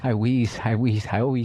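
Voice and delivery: teasing repeats, "always" squashed to "wheeze"; mocking mispronunciation. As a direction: mimic a slurred "I always" as "I wheeze". Mocking co-host's slurred "always.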